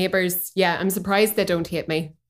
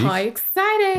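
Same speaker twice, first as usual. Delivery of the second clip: high, excited exclamation; delighted, lifted pitch; 12 semitones higher than usual. Real, not acted. Reacting to Patreon announcement.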